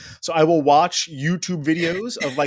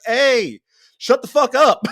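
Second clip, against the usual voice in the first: raised, high holler from bed; put-on shout to silence someone; mock angry yell; 6 semitones higher, 5 dB louder, slower than usual. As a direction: yell it like an irritated hypothetical boyfriend. Acting out yelling at someone crying.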